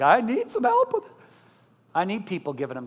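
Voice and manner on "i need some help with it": squeaky high voice for the needy parent; mock plea, pitched way up. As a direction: pitch it way up like a helpless whine. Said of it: "they're like".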